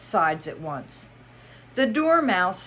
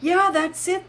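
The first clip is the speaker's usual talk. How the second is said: sighing; 13 semitones higher, 6 dB louder than usual. Acted. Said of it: "said with a sigh".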